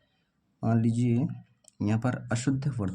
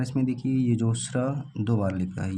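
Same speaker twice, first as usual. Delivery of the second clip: ordinary talk, nothing marked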